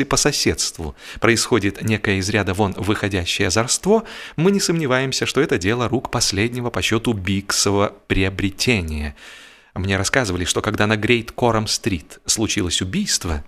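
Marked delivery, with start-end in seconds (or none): none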